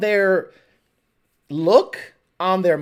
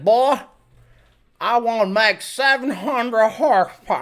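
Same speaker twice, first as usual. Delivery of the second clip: Texan boss. Retold or imitated. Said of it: "[name] just summed up most of Texas".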